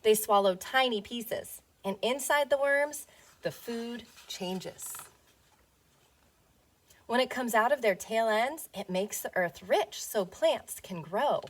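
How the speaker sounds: gentle storybook read-aloud; reading to children. Acted. Teacher reading a worm picture book to her class, storybook voice. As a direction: read it as if to little ones on the rug.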